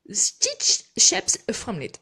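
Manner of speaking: slow, loud attempt at an English accent. Real, not acted. French crafter reading a product name to viewers, English accent. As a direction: read the product name in careful, effortful English. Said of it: "encore avec mon accent anglais".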